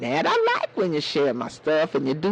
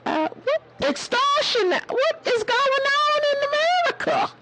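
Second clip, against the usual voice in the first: much higher, outraged squeak; shocked disbelief. Retold or imitated. She retells answering the scammer, high and incredulous. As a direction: let the pitch shoot up in disbelief. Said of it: "I said".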